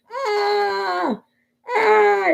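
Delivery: high, drawn-out retold scream; mock bellow of pain. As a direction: wail it out like a hammed-up toothache. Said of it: "he'd start bellowing".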